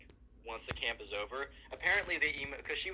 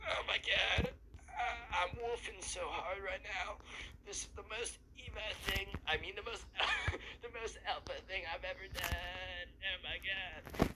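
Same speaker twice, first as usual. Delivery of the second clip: overwrought wolfing; 6 semitones higher than usual. Retold or imitated. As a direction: gush as if moved to tears by his own pose. Emo kid overwhelmed mid-pose.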